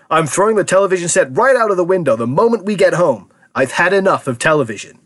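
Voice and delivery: shouted. A narrator's reading. Father banning television for good, shouting. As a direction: rant it as a fed-up father. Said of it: "shouted Mister T V".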